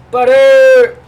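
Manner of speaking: high, loud call across the room; a bright loud call for the dog Butter. Real, not acted. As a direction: shout the dog's name to call him over. Calling out to his dog.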